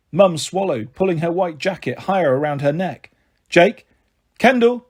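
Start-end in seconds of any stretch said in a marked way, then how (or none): 3.5-4.9 loud call, rising on each name; shouting names. A narrator's reading. Mum shouts for the missing boys, loud and worried. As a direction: call their names out across the market. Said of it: "she shouted".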